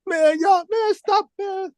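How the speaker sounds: high, squeaky mock protest; imitating his own flustered high "stop"; embarrassed protest. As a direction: brush off the praise in a high bashful voice. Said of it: "And then I was like".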